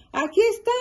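high, happy found-it call; excited shout. Acted. Froggy finding his helmet, high excited call. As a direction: announce it proudly. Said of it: "gritó Froggy".

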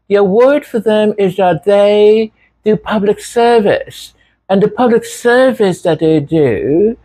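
high, dithering mock expert voice; mocking panelist. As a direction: imitate a flustered pundit, stammering through the answer. Mocks panelist's halting rehearsed answer.

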